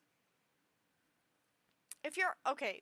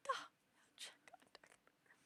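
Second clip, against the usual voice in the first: crying; 12 dB quieter than usual. Real, not acted. Tearing up while drunk.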